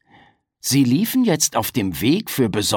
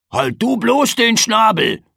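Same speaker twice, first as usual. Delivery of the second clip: raised, louder scolding; grumbling; 9 semitones higher, 5 dB louder than usual. Acted. Frederik scolds Piggledy, louder and grumpy. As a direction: snap at your little brother. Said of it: "meckerte Frederik".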